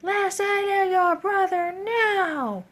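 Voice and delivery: high, slow, stretched-out scolding; the teacher snapping at the boy, mimicked high and slow; mock stern teacher. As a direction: imitate a harsh teacher barking an order, drawn out. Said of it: "And she's like".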